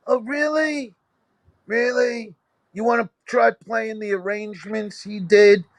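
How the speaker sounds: high, incredulous "really"; sarcastic comeback. Retold or imitated. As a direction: scoff at them in disbelief. Sarcastic reply to critics.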